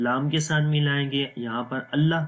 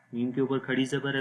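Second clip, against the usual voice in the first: usual speaking voice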